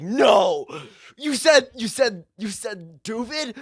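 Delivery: high, loud cries of disbelief; outraged yell. Acted. Everyone around reacting to the wrong word, in an outraged yell.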